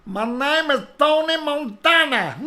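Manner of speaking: loud, high hammed-up Cuban accent with a laugh; mock Cuban gangster, laughing. Host doing a Tony Montana bit, loud hammed-up Cuban accent. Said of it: "That's my Cuban accent".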